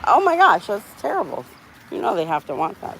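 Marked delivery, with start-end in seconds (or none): 0.1-1.4 high, sudden oh my gosh; hearing sad sports news, high dismayed exclamation; shocked reaction. Real, not acted. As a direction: gasp it out in dismay.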